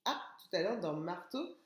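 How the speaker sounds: ordinary delivery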